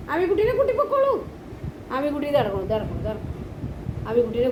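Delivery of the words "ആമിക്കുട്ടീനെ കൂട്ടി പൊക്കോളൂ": much higher pet-talk voice; high-pitched coaxing. Real, not acted. Urging Mikku to take Ami along, high coaxing.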